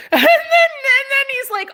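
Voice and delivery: words broken by laughter; laughing. Real, not acted. Telling the story of the neighbor, cracking up.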